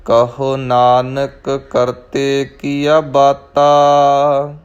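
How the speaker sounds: slow, drawn-out scripture reading with long line endings; Gurbani recitation. Shown as a demonstration. Teacher models the verse for learners, slow measured recitation. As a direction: recite it slowly, holding the final vowels.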